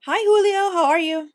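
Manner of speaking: cheery greeting. Real, not acted. Greeting someone off camera.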